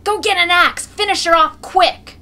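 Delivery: high, loud urgent yell; urgent shout. A narrator's reading. Someone in the crowd urging to finish the mare off, shouting. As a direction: holler for an axe. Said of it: "someone else shouts".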